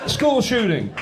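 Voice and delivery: high, hammed-up American; mock American accent. Englishman going up to an American, mock American accent. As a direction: blurt it in a high fake American voice. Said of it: "me going up to an American".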